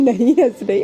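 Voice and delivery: giggling through the denial; laughing while denying. A narrator's reading. Alisha denying she is laughing, while giggling. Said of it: "ये बोलते हुए हंस रही थी".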